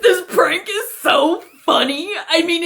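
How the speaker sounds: lightly high, drawn-out prankster voice; a hint of giggly self-congratulation; self-amused prankster. Prankster narrating a prank to viewers, giggly and pleased.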